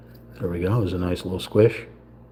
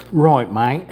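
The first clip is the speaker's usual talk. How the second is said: mock British accent; 4 semitones higher than usual. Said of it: "I start talking like this".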